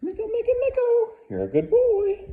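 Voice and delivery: very high, loud excited squealing. Shown as a demonstration. Trainer proofing the dog's down, high-pitched squeal. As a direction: squeal the name over and over to tempt the dog. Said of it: "with a higher pitched voice".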